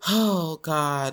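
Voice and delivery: usual speaking voice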